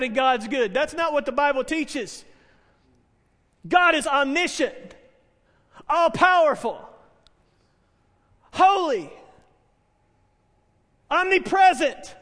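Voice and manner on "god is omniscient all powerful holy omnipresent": raised, ringing preacher's declaration; high, forceful, measured preaching; emphatic proclamation. Real, not acted. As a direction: proclaim each attribute loudly, with pauses between. Preacher proclaims God's attributes.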